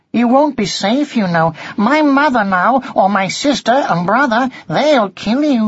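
high, emphatic warning; concerned young cat. A narrator's reading. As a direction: warn the little ones earnestly, pitched high. Cat warns young mice.

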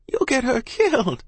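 high, alarmed mother's cry. A narrator's reading. Mother fears for her child, crying out. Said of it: "Ma cried".

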